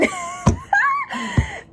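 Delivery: very high, drawn-out shrieking laugh; long squealing laugh; cackle. Real, not acted. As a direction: cackle at your own joke. Laughs at dead woman line.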